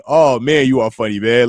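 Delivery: normal talking voice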